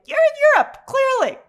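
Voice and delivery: high, loud mock exasperation; sarcastic disbelief. Reviewer calling out the Europe set, high sarcastic scoff. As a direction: say it like it's painfully obvious. Mocking the film's fake New York.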